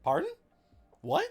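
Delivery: squeaky mock excuse me; mock incredulous. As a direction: act out stunned disbelief. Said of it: "And you're like".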